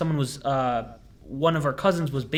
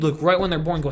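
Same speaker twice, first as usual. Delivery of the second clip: normal talking voice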